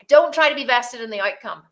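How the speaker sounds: normal talking voice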